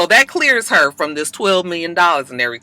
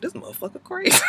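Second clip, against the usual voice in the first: high disbelieving squeal; incredulous; 7 dB quieter, faster than usual. Retold or imitated. Viewer reacting to Eli's craziness, high disbelief. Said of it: "you're like".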